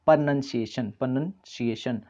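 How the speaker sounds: प्रोनंसिएशन with 'नन', not 'नाउन'; correct pronunciation. Shown as a demonstration. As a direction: say प्रोनंसिएशन carefully, nun not noun. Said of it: "उच्चारण हुआ वो हो गया". (प्रोनंसिएशन को 'नन' के साथ साफ़ बोलना)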